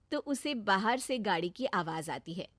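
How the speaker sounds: ordinary delivery, nothing marked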